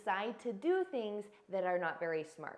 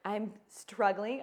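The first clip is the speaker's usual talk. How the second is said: struggling; 4 dB louder, much slower than usual. Retold or imitated. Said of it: "if I'm thinking".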